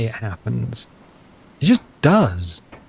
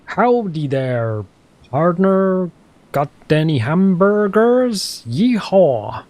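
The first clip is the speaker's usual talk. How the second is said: slow, hammed-up American cowboy accent; 5 semitones higher, much slower than usual. Shown as a demonstration. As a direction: drawl it out like a mock American cowboy. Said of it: "That's my American accent".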